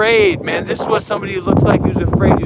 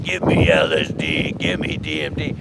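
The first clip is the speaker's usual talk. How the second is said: mocking the friend. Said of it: "He's talking about".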